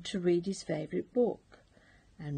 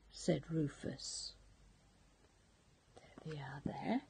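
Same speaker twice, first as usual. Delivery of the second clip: ordinary delivery, nothing marked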